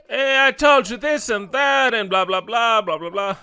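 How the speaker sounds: high, loud nagging tirade; yelling wife. Acted. Wife scolding someone over not listening, yelling. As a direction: yell it like a scolding wife, easing off at the end. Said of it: "the yelling wife, yelling".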